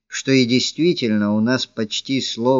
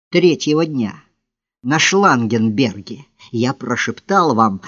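plain reading voice

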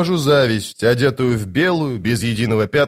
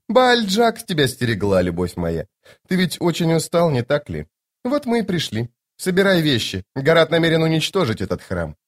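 Lady Envy, soothing; 5 semitones higher than usual. Acted. Lady Envy telling her love to pack, high and soothing. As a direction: coax lightly in a high register.

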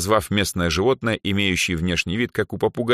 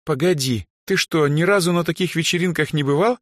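high, incredulous question; astonished girl's voice, pitched up; amazed; 10 semitones higher than usual. A narrator's reading. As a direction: stare in amazement as you ask. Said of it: "изумлённо уставились на меня".

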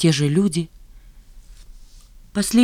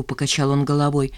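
plain reading voice

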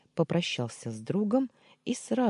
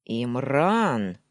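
firm, louder call of a name; stern call; 2 semitones lower, 7 dB louder than usual. A narrator's reading. Man demands an answer from his friend, sternly calling. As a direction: call him sharply, demanding. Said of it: "строгим голосом позвал я".

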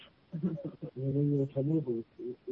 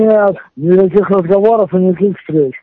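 loud; 7 dB louder than usual. Real, not acted. Refuses angrily.

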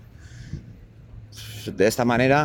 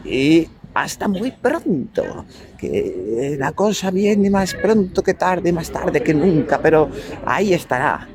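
sneering panto villain; 8 semitones higher, 4 dB louder than usual. Acted. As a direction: gloat the farewell like a panto baddie. Theatrical sign-off promising the next show.

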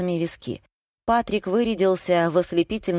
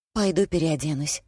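plain reading voice

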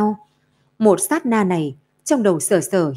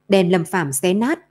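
usual speaking voice

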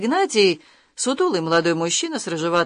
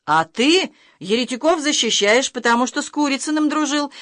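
higher, heated accusation; mildly hot-headed, raised-pitch outburst; heated retort; 7 semitones higher than usual. A narrator's reading. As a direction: fire back in a temper. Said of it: "в запальчивости крикнул Влас".

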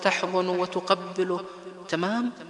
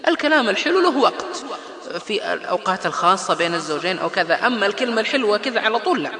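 louder, higher, laughing know-it-all; mock the smug adviser; preacher mocking a bad adviser, higher and laughing; 5 semitones higher, 4 dB louder than usual. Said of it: "قال".

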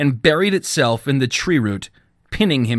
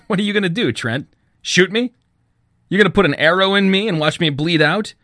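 higher-pitched taunting sneer; 6 semitones higher, faster than usual. A narrator's reading. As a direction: taunt him with scornful questions. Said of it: "The young man sneered".

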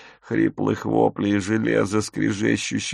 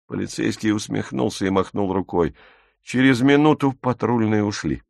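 ordinary delivery, nothing marked

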